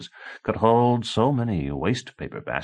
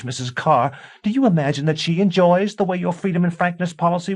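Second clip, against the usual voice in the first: high, loud screaming tirade; screaming wife; 12 semitones higher, 5 dB louder than usual. A narrator's reading. A wife screaming warnings at her husband. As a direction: shriek the warning at him.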